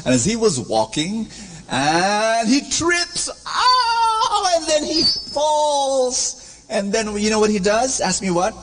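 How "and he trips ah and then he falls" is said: hammed-up stumble cry. Acting out the trip.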